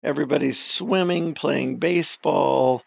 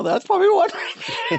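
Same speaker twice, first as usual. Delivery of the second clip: laughing shout; 3 dB louder, faster than usual. Real, not acted. Laughing at own cliche.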